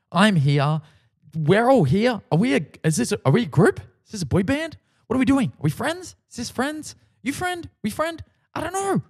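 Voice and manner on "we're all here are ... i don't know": high, breaking-up confused questions. Real, not acted. Standing on stage beside his idols, baffled and pitched high. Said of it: "I'm so confused".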